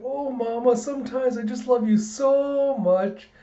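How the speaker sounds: put-on high, sugary child voice; over-the-top cutesy affection; gushing little girl. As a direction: lay on the adoration, slowing at the end. Child gushing to her mother.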